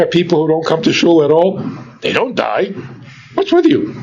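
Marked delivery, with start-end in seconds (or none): 3.3-3.9 much higher, mocking disbelief; teasing challenge; incredulous. Real, not acted. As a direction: needle them with mock amazement. Preacher mocking fearful congregants.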